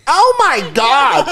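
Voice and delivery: the prissy waitress scandalized; mock horrified actress; shocked waitress. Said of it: "and then the waitress goes".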